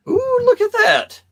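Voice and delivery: high, slow delighted coo; excited squeal. Real, not acted. Host admiring a friend's custom paint job, delighted squeal. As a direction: gush over the figure, drawn out and high.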